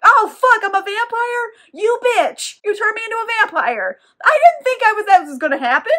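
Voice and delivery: mock-hysterical yelling at the one who turned him; imitated outraged shock. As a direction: shriek it like a betrayed teen waking up a vampire. Vittorio wakes up turned vampire.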